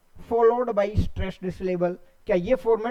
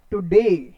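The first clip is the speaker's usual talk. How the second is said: Today with a light टु and a strong, louder डे; stress on the second syllable of today; 3 semitones lower, 6 dB louder, much slower than usual. Shown as a demonstration. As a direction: lean on the डे in today. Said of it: "डे के ऊपर stress है". (Today में टु हल्का, डे पर ज़ोर)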